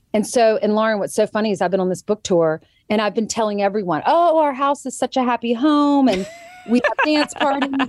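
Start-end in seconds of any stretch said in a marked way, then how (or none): 4.1-7.9 high, hammed-up sunny voice; mock cheerful. Host mocking her own happy-home talk, high mock cheer. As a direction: send up your own rosy boasting.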